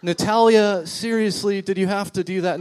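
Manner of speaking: incredulous call-out to Natalia; exasperated. Retold or imitated. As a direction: playfully scold your wife. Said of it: "And I was like".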